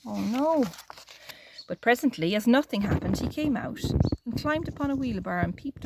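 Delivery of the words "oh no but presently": usual speaking voice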